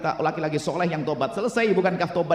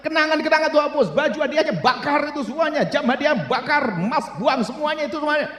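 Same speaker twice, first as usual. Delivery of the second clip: high, loud, driving sermon; fervent pulpit exhortation; fiery preaching; 10 semitones higher, 5 dB louder than usual. Acted. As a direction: hammer the warning home to the crowd. Preaching at full force.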